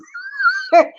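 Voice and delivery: sudden squeaky laugh; high burst of laughter. Real, not acted. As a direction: let out a high-pitched laugh. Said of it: "I'm not laughing".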